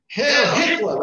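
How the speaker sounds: mock shout of a passerby; imitating a scream. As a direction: yell it out like a man from a car window. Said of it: "Like, you know, screaming".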